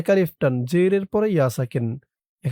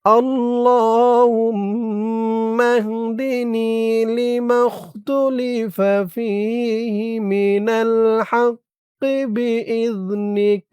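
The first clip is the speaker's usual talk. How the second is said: drawn-out, melodic dua chanting; slow Quranic-style recitation of a prayer; slow Arabic recitation; 5 semitones higher, much slower than usual. Shown as a demonstration. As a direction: recite each syllable long and lilting. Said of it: "আমার সাথে বলুন".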